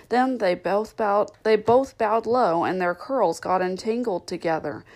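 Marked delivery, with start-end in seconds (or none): none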